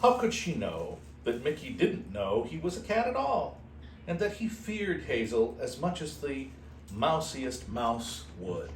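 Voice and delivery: usual speaking voice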